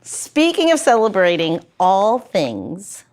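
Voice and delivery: usual speaking voice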